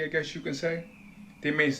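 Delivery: normal talking voice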